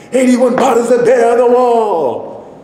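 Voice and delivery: high, loud screamed counting song; crazed high yell; demented screaming. Retold or imitated. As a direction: scream it like someone in a demented fit.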